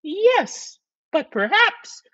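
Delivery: very high falsetto; high-pitched, tentative wife; suggesting wife. Acted. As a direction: suggest it in a piping wife's voice.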